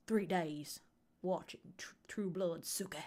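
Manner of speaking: low, soft mock Louisiana accent; hammed-up, hushed Louisiana accent; thick Louisiana accent. Shown as a demonstration. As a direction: sink into an exaggerated Louisiana accent, almost whispering. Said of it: "try to do a Louisiana accent".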